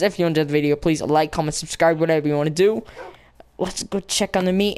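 ordinary talk, nothing marked

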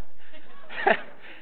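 soft unvoiced laugh to herself; breathy laugh. Real, not acted. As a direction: laugh quietly at the memory. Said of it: "sorry, that was just".

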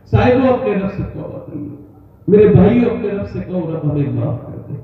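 earnest sermon plea to the listeners; preacher begging the faithful to repent; preaching appeal. Acted. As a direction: urge the crowd to repent.

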